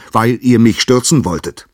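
loud, high call from above; Uranus shouts his sentence down into the dark. Acted. Uranus calling down to his sons, loud and high. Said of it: "rief er ihnen von oben zu".

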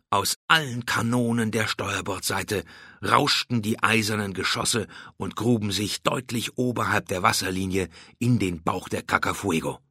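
ordinary delivery

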